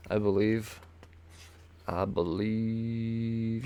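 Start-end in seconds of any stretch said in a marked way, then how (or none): 1.9-3.6 stretched and softer, very slow; a lazy, musing repeat to himself; slow drawn-out echo. Shown as a demonstration. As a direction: drag it out like a playful echo. Drawn-out echo of his own words.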